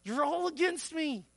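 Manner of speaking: high, loud defensive protest; defiant. Acted. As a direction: protest like someone digging their heels in. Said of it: "who puts their heels in the ground".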